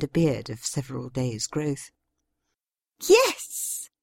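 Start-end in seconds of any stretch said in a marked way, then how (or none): 3.0-3.9 high excited cry; exclaimed. A narrator's reading. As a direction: burst out delighted. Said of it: "Karina exclaimed".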